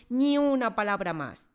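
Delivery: loud, high silencing shout; loud commanding bark. Acted. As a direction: shut her up with a yell. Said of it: "gritó la señorita Trunchbull".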